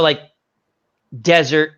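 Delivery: ordinary delivery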